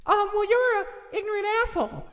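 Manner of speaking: very high falsetto comeback; hammed-up falsetto quarreler; bickering partner. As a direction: squeak it back in a put-on high voice. Acting both sides of a quarrel.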